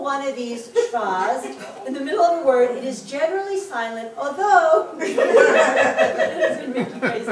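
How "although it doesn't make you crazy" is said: breaking into loud laughter mid-sentence; laughing. Real, not acted. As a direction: laugh your way through it, loud and drawn out. Teacher cracks up over the rules.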